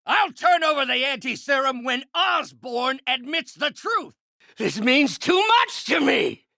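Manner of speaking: high, strained defiant shout; desperate shouting. Acted. Villain refuses to hand over anti-serum, desperate high shout. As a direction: shout your terms at an enemy.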